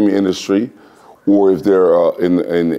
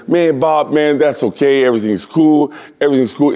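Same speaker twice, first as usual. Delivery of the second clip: mild imitation of car owner; 9 semitones higher than usual.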